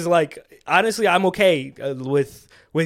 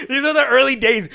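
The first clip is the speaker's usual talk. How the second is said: laughing; 8 semitones higher, 7 dB louder than usual. Real, not acted. Joking about early episodes.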